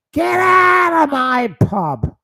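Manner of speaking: very high, loud, stretched landlady yell; Peggy Mitchell. Acted. Host imitates the pub landlady ordering someone out, screamed high. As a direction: bellow it high and slow like a landlady. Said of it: "what's Peggy Windsor would say".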